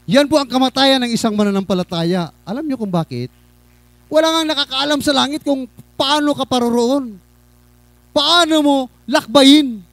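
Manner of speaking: high, projected sermon voice; impassioned preaching. Real, not acted. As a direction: put the challenge to the congregation from the pulpit. Preaches to congregation. (masidhing pangangaral)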